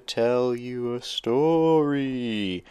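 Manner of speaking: slow, drawn-out spooky ghost voice. Retold or imitated. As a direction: moan it slowly like a ghost. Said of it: "she's talking like a ghost".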